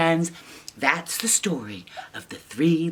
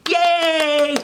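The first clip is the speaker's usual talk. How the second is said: plain reading voice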